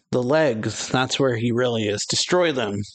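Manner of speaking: usual speaking voice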